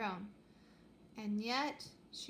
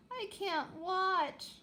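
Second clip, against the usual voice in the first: high, slow wail of dread; 9 semitones higher, 3 dB louder, much slower than usual. Acted. Astra covering her eyes at the fight, slow high wail. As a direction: moan it, unable to look. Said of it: "wailed Astra".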